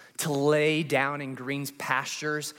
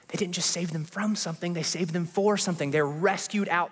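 preaching at full force, higher and building over swelling music; rising preaching; 7 semitones higher than usual. Acted. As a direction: build the altar-call cadence line by line.

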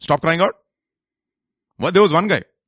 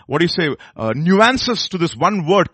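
ordinary delivery

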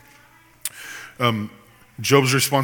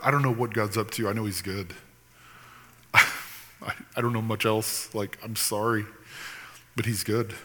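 quiet, softer, sincere admission; soft sincere confession; 3 semitones lower, 8 dB quieter than usual. Real, not acted. Preacher tells a sufferer what he honestly says, softly. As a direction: say it humbly and softly. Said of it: "A lot of times I'll say that".

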